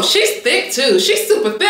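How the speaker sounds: overexcited, breaking into laughter; dramatic excitement. Real, not acted. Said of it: "I'm being so dramatic".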